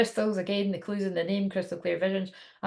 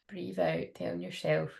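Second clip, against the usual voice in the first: slow soft meditation voice; guided meditation; 4 dB quieter, slower than usual. Acted. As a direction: ease them into calm breathing. Leads a guided breathing meditation.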